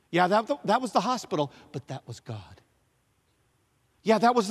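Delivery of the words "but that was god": hushed. Acted. Refrain contrasting human helper.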